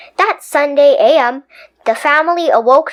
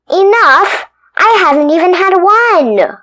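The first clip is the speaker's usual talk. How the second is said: higher, louder outraged tooth; indignant tooth; 7 semitones higher, 6 dB louder than usual. Acted. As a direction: protest loudly.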